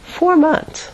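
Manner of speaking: high-pitched, delighted realization; budding enthusiasm. Retold or imitated. Realizing months off are ahead, budding enthusiasm. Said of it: "started to get a little bit enthusiastic".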